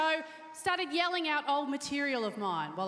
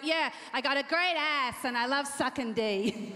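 slow, put-on sassy brag; half-hearted mock sass; fake sass; slower than usual. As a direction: try on a sassy attitude badly. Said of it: "It's like".

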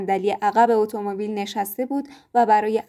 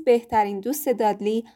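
plain reading voice

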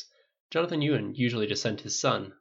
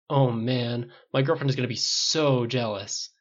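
usual speaking voice

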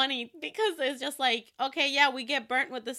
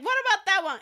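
high, loud fangirl imitation; mock-eager, insistent; mimicked eager question; 10 semitones higher, 7 dB louder, much faster than usual. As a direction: ask like an overexcited friend, exaggerated. Mimics Marianne asking about same member.

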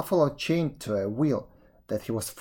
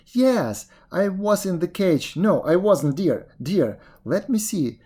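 high, flustered mock fright; pretend afraid; 6 semitones higher, 4 dB louder than usual. A narrator's reading. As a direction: fluster in feigned alarm. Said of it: "pretending to be afraid".

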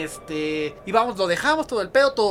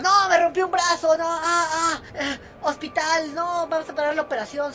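taxista quejumbroso; 7 semitones higher than usual. Retold or imitated. Taxista finge lesión exagerada.

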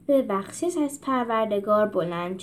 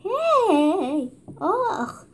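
startled yelp; 9 semitones higher, much slower than usual. A narrator's reading. Arrow whizzes past.

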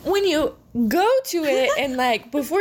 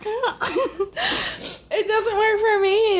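crying with laughter; 9 semitones higher, much slower than usual. Real, not acted.

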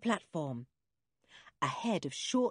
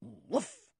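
airy little woof, rising; whispery whuff of a dog; dog bark. A narrator's reading. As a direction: puff out a small dog's bark. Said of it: "said Timmy".

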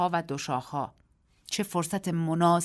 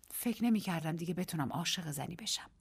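softer, intimate voice; tender confession; 7 dB quieter than usual. A narrator's reading. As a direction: confess it quietly. (آرام و صمیمی)

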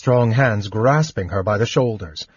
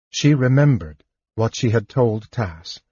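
ordinary delivery, nothing marked

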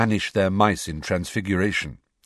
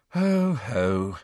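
bored; 3 semitones higher than usual. A narrator's reading. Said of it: "in a bored voice".

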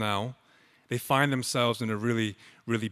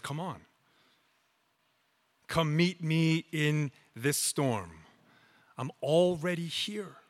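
soft, tender Jesus inviting Peter; softer, unhurried, a gentle call into the storm; gentle invitation; 6 dB quieter than usual. Acted.

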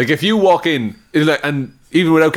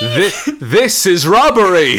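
slow, laughing declaration pitched up; laughing robber; 7 semitones higher, 3 dB louder, slower than usual. Pretend. Robber declaring the robbery, laughing. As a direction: announce it while cracking up.

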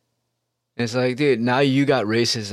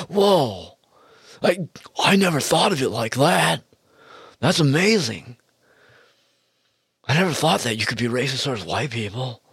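higher-pitched imitation of awestruck listeners; mock amazement; 3 semitones higher than usual. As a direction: act out a wide-eyed revelation, pitched up.

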